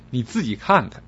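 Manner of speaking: normal talking voice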